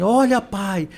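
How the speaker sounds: put-on excited child's call, high-pitched; mock shout of a boy spotting a snake. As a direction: call out to dad like a startled kid. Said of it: "o menino grita assim".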